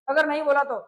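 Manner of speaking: ordinary talk, nothing marked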